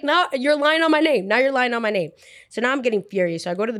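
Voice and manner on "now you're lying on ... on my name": higher-pitched protest, easing down on the repeat; scandalised and insistent; indignant accusation. Retold or imitated. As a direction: retell it as an outraged call-out. Acted quarrel over false accusation.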